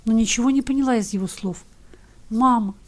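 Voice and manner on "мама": ordinary delivery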